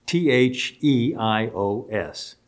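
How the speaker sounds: usual speaking voice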